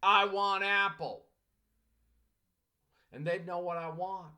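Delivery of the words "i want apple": louder, slow broken-English request; hammed-up learner asking for apple, louder and slow; broken English demo. Shown as a demonstration. Teacher acting a learner ordering from a clerk, louder and slow. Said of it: "go to the produce clerk and say".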